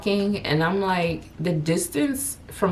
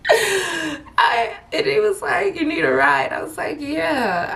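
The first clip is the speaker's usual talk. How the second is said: high, laughing through the words; can't stop laughing while telling it; giggling high retelling; 11 semitones higher than usual. Real, not acted.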